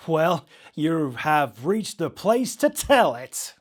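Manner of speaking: loud, slow DJ build, climbing high; radio DJ hype. Acted. DJ invites his caller's strange story, radio DJ hype. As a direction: play up the invitation like a radio host.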